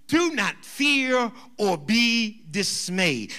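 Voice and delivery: slow, measured, sustained reading of a verse; solemn scripture reading. Acted. Preacher reading Deuteronomy to the congregation, solemn scripture reading. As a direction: draw out each phrase with weight and pauses.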